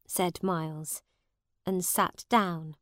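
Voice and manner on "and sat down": ordinary delivery, nothing marked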